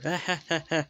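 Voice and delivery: high, loud acted cackle; gloating staccato laugh; mocking laugh. A narrator's reading. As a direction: laugh out loud at their misfortune. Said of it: "laughed Anti".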